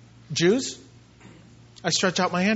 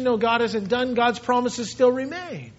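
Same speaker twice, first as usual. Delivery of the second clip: normal talking voice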